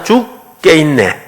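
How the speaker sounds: very slow, stretched 죽게 있네 with a laugh; drawn-out demo. Shown as a demonstration. Preacher demonstrating the turn from 죽 to 죽게 있네, slow and laughing. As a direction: stretch 죽게 있네 out slowly, chuckling. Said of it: "죽까지는 하고 빨리 정신 차리고".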